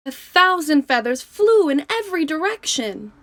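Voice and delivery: lively, expressive storybook reading; reading to children. A narrator's reading. Teacher reading a picture book to her class, lively and expressive. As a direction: read it like a bedtime story for kids.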